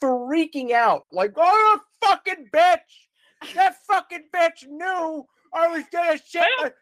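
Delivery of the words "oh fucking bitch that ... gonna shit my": dad screaming curses in a Walmart parking lot; screaming bloody murder. Retold or imitated. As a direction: scream it in a raging panic. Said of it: "Just screaming bloody murder".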